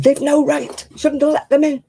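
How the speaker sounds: a hint of an indignant grown-up son; son's stern telling-off. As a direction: tell her off like a cross son. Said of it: "My son said".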